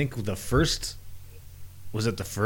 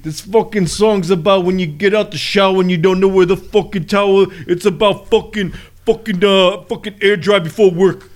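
Brooklyn accent impression; 5 semitones higher, 7 dB louder than usual. Friend joins the Brooklyn frontman bit.

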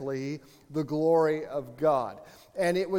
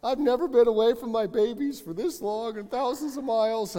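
higher, half-mock tearful wife voice; imitating teary wife; 6 semitones higher than usual. Pastor imitating his tearful wife at the airport, mock-whiny. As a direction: play the wife, mock-upset about leaving her babies. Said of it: "She's like".

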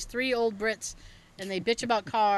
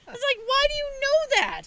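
squeaky, much higher, slow disbelief; incredulous; 4 dB louder, slower than usual. Retold or imitated. Asking dad how he knows the train store, incredulous. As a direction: stretch it out in amazed disbelief.

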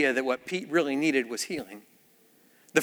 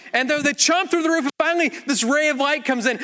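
ordinary delivery

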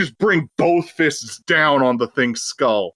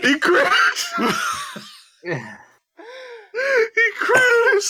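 high shrieking laugh; much slower than usual. Real, not acted. Shocked laughter at crit.

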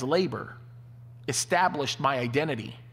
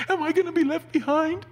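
high, loud, fretful plea; anxious question; 5 dB louder than usual. Retold or imitated. Worried churchgoer asks the preacher about the rapture, high fretful plea. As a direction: ask it like a scared churchgoer.

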